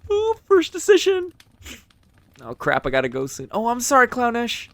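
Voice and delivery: high, loud, quick chatter; excited. Real, not acted. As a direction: burst out excitedly. Excited chat reaction.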